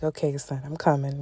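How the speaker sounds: calm mom. Retold or imitated. Mother calmly answering her crying son, soft and unhurried. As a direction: soothe him without rushing. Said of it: "Mind you, I'm calm".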